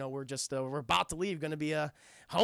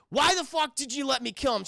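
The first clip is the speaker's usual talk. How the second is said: raised, higher-pitched acted outrage; angry demand; 6 semitones higher, 5 dB louder than usual. Asimov confronts his partner after the choking, acted angry demand. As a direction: demand an answer, loud and heated.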